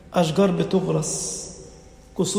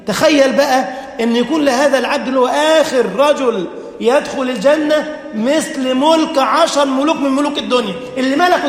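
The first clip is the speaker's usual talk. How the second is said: raised, excited sermon voice; preacher marvelling at the last man's reward; fervent preaching; 6 semitones higher, 3 dB louder than usual. Acted. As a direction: build the wonder for the crowd. Preaching at full force.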